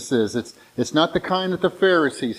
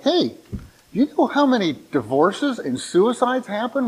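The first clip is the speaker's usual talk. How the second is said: lightly voiced wife, pitched higher; imitating wife at breakfast; 8 semitones higher than usual. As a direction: raise the pitch for the wife's sudden question. Voices wife reading alarming paper.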